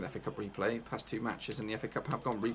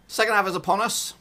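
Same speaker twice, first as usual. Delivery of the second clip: announcer call; 8 semitones higher, 10 dB louder, faster than usual. Real, not acted. Announcing second half.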